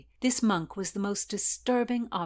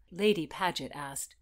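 plain reading voice

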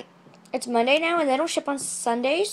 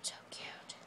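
whisper; 21 dB quieter than usual. Real, not acted. Whispered aside.

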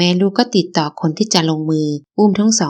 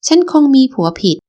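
higher, louder bitter cry; bitter outcry; 7 semitones higher, 4 dB louder than usual. Acted. Wife crying out bitterly at her husband, loud. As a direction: lash out in regret.

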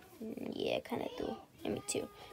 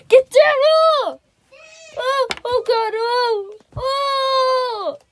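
high loud panicked cries; panicked yelling; 10 semitones higher, 7 dB louder, much slower than usual. Acted. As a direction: scream in alarm. Panic over cough.

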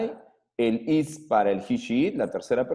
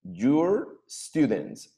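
slow model phrase; 3 semitones higher, much slower than usual. Shown as a demonstration. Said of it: "You're, you're students".

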